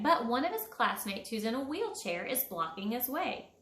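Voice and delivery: ordinary delivery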